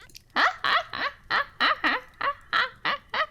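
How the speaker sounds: high, loud staccato imitation laugh; forceful put-on rhythmic cackle; Pekora laugh. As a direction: practise a cartoonish laugh in rapid bursts. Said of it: "Am I doing it correctly?".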